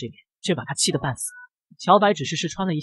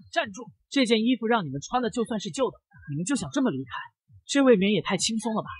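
angry; 6 semitones higher, 3 dB quieter than usual. A narrator's reading. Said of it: "这让他莫名的火大".

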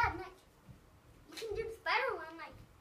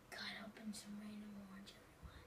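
whispered aside to the audience; 8 semitones lower, 14 dB quieter than usual. Real, not acted. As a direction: whisper it to the viewers. Aside to viewers.

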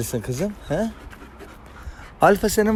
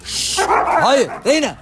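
high, sharp command to the dog; sharp call; 10 semitones higher, slower than usual. Real, not acted. Owner sharply stops his dog Reyna mid-scuffle, calling high. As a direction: cut the dog off, high and firm. Dogs fighting, he calls out.

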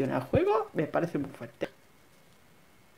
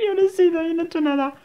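high-pitched protest of innocence; squeaky "wasn't me" plea; high innocent denial; 10 semitones higher, 5 dB louder, faster than usual. Acted. Caught after breaking something.

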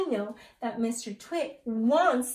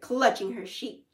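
plain reading voice

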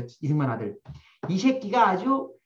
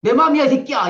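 put-on loud, higher childish shout of defiance; kid's angry yell; 6 semitones higher, 8 dB louder than usual. Kids squabbling, one shouts back defiantly. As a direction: yell it like a kid in a schoolyard fight. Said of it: "싸우면 뭐라 그래요".

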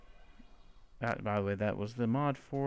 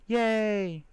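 happy cheer; 6 semitones higher, 4 dB louder than usual. Real, not acted. Got the disc.

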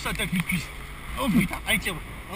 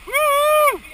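loud high whooping cheer; excited shouting; 9 semitones higher, 15 dB louder, much slower than usual. Real, not acted. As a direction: cheer at the top of your lungs. Cheering during the fast descent.

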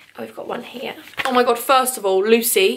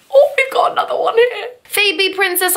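excited squeal; 8 semitones higher, 3 dB louder than usual. Real, not acted. Excited at new card.

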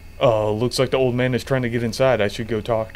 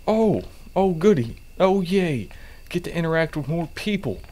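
sarcastic glee; 5 semitones higher than usual. Real, not acted. Sarcastic about customers.

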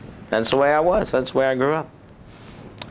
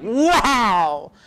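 amazed; 7 semitones higher than usual. Retold or imitated. Retelling being knocked down.

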